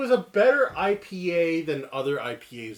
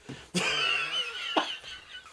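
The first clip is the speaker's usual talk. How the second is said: high squealing laugh; 5 dB quieter than usual. Real, not acted. Laughs at chug.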